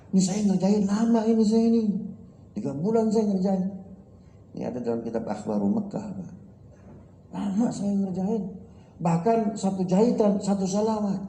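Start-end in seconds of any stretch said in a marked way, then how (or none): 0.1-3.7 high, pleading protest from the tailor; the tailor cries out that the work took him ages; indignant tailor. Acted. Tailor protesting to the buyer, high and aggrieved. 7.3-11.2 high, pleading protest from the tailor; the tailor cries out that the work took him ages; indignant tailor. Acted. Tailor protesting to the buyer, high and aggrieved.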